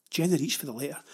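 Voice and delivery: plain reading voice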